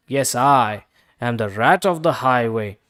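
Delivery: loom over the duck hungrily; menacing rat. A narrator's reading. Rat boasting.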